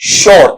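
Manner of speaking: "श्योर" with a full final r; common mispronunciation. Shown as a demonstration. Teacher shows how people often read it, mispronounced. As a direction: say "श्योर", sounding the r clearly. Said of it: "श्योर ऐसा भी नहीं पढ़ेंगे".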